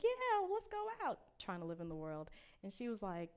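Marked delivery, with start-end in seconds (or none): 0.0-1.2 eager agreement. Retold or imitated. Eager yes to going out.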